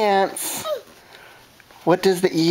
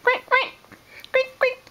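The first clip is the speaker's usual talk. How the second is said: high quacking duck; much faster than usual. Retold or imitated. Parent quacks for toddler, high duck voice.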